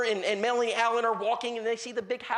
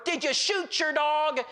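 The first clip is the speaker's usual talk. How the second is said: raised, drawn-out cranky retort; lightly acted grumpy neighbor; mock grumpy man; 8 semitones higher, slower than usual. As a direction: imitate a cranky neighbor interrupting. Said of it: "He is grumpy".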